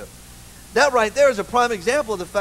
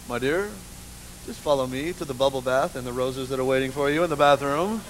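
low, soft, seductive husband; romantic husband; 7 semitones lower, 5 dB quieter than usual. Retold or imitated. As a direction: lower and softer, leading her in. Husband voiced in story.

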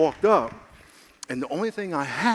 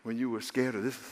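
softer, low reassuring reminder; gentle voice of God; 5 semitones lower, 9 dB quieter than usual. Retold or imitated. God reminding the nervous preacher, softly reassuring. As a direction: reassure him quietly. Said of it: "and God said".

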